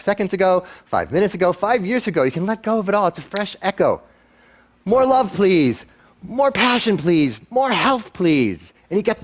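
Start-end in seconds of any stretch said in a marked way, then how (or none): 4.8-8.7 slow, high call sent out to the universe. Shown as a demonstration. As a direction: call each wish out, drawn long and high. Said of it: "If we shout out".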